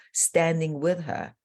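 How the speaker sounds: ordinary delivery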